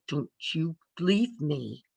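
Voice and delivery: slow, hushed, pleading grip on the arm; desperate plea. Retold or imitated. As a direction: beg her not to go, drawn out. New nurse begging, grabbing arm.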